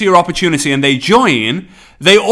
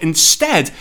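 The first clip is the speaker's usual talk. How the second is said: normal talking voice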